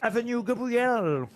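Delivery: hammed-up English accent over French words; French host showing off his English accent; best English accent. Shown as a demonstration. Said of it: "mon meilleur accent anglais".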